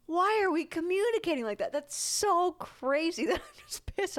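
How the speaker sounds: high, incredulous rant; exasperated disbelief. Real, not acted. Partner vents frustration about their fights, exasperated. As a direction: vent at how crazy it is.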